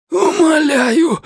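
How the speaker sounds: high, cracking desperate cry; breaking voice scream. Acted. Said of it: "срывающимся голосом закричал он". (срывающийся крик)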